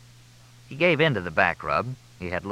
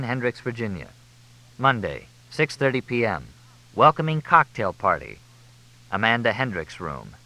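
usual speaking voice

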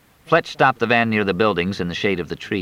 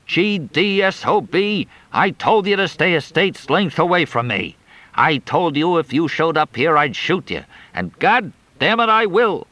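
yelling in fury; 9 semitones higher than usual. Acted. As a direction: rage at the intruder. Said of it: "yelling his head off".

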